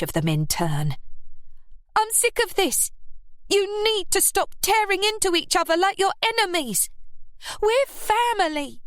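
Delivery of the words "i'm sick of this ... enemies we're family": put-on high, insistent young voice; stressed. A narrator's reading. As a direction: press each word, pitched high. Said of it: "she stressed".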